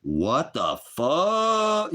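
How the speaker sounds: drawn-out, high, loud disbelief; stunned outrage. Retold or imitated. Retelling his reaction to the bank's refusal, drawn-out outraged disbelief. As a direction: stretch it out in shocked outrage. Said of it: "I'm like".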